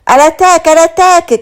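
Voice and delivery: high, loud charging shout; rally the troops with a cry; battle cry. A narrator's reading. Leading the cutlery into the attack, a loud battle cry. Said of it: "crie mademoiselle Lapelle à Tarte".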